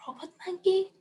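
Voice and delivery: high soft disbelief; mock disbelief. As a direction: ask in silly shock. Incredulous at the text.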